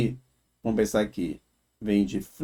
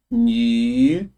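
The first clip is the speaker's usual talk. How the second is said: nitrogênio said higher and slower, rising at the end; emphatic repeat; 7 semitones higher, slower than usual. Shown as a demonstration. Teacher repeating the example word for students, higher and slower. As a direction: lift nitrogênio up as if holding it up to show. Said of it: "a palavra nitrogênio".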